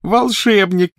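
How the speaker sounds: high, loud scornful squeal; shrieking. A narrator's reading. Petunia jeering at the boy's claim, shrieking. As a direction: squeal the word in mockery. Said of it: "взвизгнула она".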